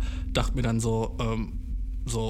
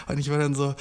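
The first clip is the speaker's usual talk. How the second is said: usual speaking voice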